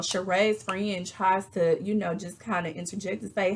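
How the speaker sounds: ordinary delivery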